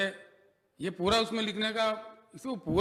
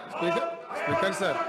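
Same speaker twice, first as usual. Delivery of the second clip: calling out; 9 semitones higher than usual. Real, not acted. Minister calling over the house to the Speaker, high-pitched call.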